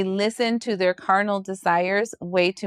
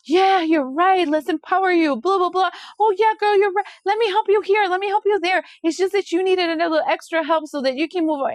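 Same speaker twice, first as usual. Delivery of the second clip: mock sweet friend; 7 semitones higher than usual. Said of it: "And you're just like".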